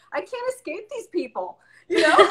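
high, laughing through the words; real laugh breaking in; giggling exasperation. Real, not acted. Laughing at her own kids.

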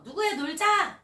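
loud, high call to a friend; shouted slogan. Shown as a demonstration. Child practising calling a friend to play, shouting. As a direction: yell it out across the playground. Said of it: "크게 외쳐보는 거예요".

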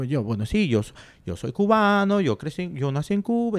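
hint of a proud Cuban; Cuban accent. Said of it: "he goes on into full Cuban mode".

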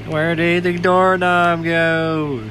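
high, loud, slow yell; frustrated yell. Real, not acted.

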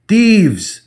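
high, loud cry of alarm; sudden exclamation. Acted. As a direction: yell it in shock. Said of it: "the professor suddenly exclaimed".

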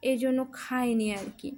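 ordinary delivery

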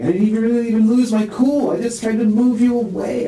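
high, strained voice on the edge of tears; distressed. Real, not acted. Man telling the interviewer he never meant her to fall, distressed and high.

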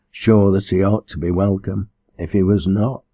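ordinary delivery

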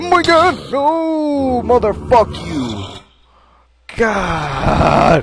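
high, loud, drawn-out wail; anguished scream. Real, not acted. Gamer who has just died in game, anguished scream. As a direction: scream in despair, stretching the words out.

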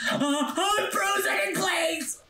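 slow, high shouted complaint; laughing through the frustration; laughing yell. Real, not acted. Frozen again in game.

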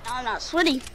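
ordinary talk

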